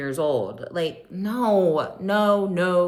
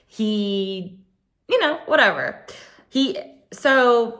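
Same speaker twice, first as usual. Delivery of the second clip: ordinary talk, nothing marked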